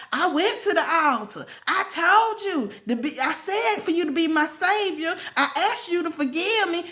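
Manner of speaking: insist, as if you are owed credit; self-righteous protest. Retold or imitated. Retelling own prayer to the Lord, self-righteous protest. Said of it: "all self-righteous with this whole attitude".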